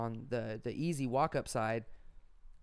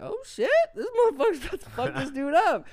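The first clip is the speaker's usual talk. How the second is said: alarmed excitement; 14 semitones higher, 9 dB louder than usual. Retold or imitated. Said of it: "I'm like".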